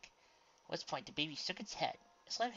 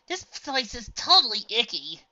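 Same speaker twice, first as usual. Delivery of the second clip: put-on girly disgust; 10 semitones higher, 14 dB louder than usual. A narrator's reading. Clover, a girly pony voice.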